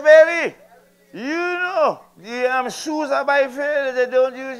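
loud, high coaxing plea to his wife; mock pleading to wife. Husband coaxing wife about unused shoes, loud high plea. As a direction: whine it up high like a sheepish husband.

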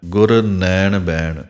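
ਨੈਣ ਬੈਣ said slowly with the open ai vowel, ਨੈਣ as nɛɳ; ਨੈਣ ਬੈਣ clearly, not ਨਯਣ ਬਯਣ; careful pronunciation. Shown as a demonstration. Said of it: "ਅਗਲਾ ਪਾਠ ਜੋ ਧਿਆਨ ਦੇ ਕੇ ਬੋਲਣਾ ਹੈ". (ਨੈਣ ਬੈਣ ਦੁਲਾਵਾਂ ਦੀ ਆਵਾਜ਼ ਨਾਲ)

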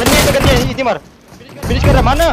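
loud, high and urgent; heated combat shout. Real, not acted. Player pressing teammate to shoot in a firefight, heated shout.

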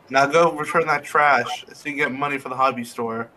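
ordinary talk, nothing marked

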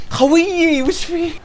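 drawn-out high disbelieving complaint; incredulous whine. Real, not acted. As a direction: ask the teammate what happened in disbelief. Teammate blunders.

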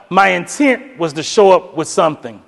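ordinary talk

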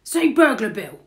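booming burglar prompt, falling; burglar character. A narrator's reading. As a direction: instruct the baby loudly. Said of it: "he says".

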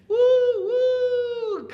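high, loud train whistle woo. Shown as a demonstration. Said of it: "great job with those sounds".

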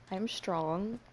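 usual speaking voice

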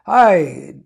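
ordinary talk, nothing marked

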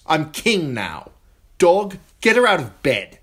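put-on spiteful boy king ordering his guard; imperious command. A narrator's reading. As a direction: snap the order at his dog. Joffrey orders the Hound.

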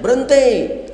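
high-pitched mock cry to stop; mock yell. Preacher showing a wife stopping a kiss, high mock yell. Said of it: "Ibu teriak".

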